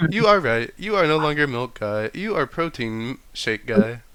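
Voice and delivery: high, loud deadpan robot. Acted. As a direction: declare it like a smug robot. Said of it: "The robot goes".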